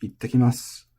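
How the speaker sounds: ittakimasu said carefully as a model; Japanese demo word. Shown as a demonstration. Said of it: "here we have this".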